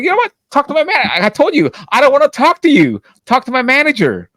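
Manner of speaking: high-pitched retold outburst; reenacted heated dismissal; exasperated telling-off. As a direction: cut the customer off, voice jumping up. Retold argument with complaining customer.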